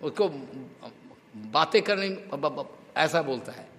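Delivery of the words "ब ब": soft stuck "ब-, ब-"; imitated stammer. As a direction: stammer on the "ब" without getting the word out. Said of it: "उसका stammering है". (हकलाते हुए धीमे से "ब-, ब-")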